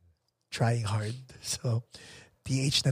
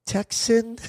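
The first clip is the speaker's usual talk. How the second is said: slow "Tucson" in attempted American accent; put-on American accent; 5 semitones higher than usual. Caller retrying "Tucson" for the operator, slow put-on American accent. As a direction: draw out "Tucson" with a careful American twang. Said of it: "Maybe I should put the accent".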